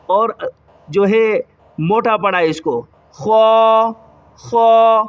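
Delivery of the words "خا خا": خا with mouth rounded, heavy and louder; rounded kha. Shown as a demonstration. Teacher drilling children, louder rounded خا. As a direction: round the lips and give a full heavy خا. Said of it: "منہ کو تھوڑا سا گول کیا".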